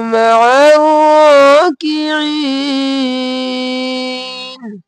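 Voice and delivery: slow, loud, drawn-out melodic recitation; full recitation. Shown as a demonstration. Teacher recites the full verse for students, loud and melodic. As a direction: chant the whole verse slowly. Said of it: "इस आयत को देख लीजिए".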